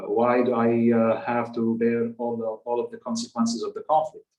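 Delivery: normal talking voice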